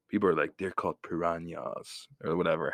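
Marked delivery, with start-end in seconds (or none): 0.6-2.1 piranhas slowed and carefully enunciated by a know-it-all; mock expert saying piranhas; scientific pronunciation. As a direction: correct someone on piranhas, slow and precise. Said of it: "very scientific".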